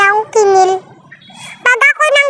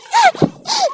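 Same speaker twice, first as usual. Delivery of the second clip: high burst of laughter; 11 semitones higher, 3 dB quieter than usual. Real, not acted. Laughs at own joke.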